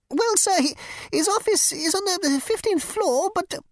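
high, fussy insect voice; insect receptionist. Acted. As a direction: squeak it like a prissy bug. Insect flustered.

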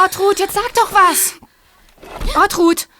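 loud high worried call; to someone hard of hearing. Acted. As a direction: shout to rouse her from the trance. Friend calls to the entranced seer.

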